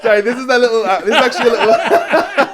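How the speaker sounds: high, loud, laughing through the words; words breaking up into laughter; cracking up. Real, not acted. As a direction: try to explain while helpless with laughter. Cracking up while showing the purse.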